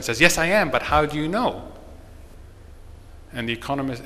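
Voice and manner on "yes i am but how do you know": a touch of eager excitement, louder; pleased to be recognized, mock eager; excited. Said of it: "very excited that someone has recognized".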